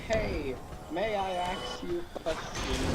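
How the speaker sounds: slow, lightly put-on polite voice; polite player. Player asks enemies a question, mock polite. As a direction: ask sweetly and slowly. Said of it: "You say".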